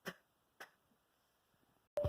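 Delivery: "p" said alone, twice; isolated sound. Shown as a demonstration. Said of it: "l'autre qui commence par p".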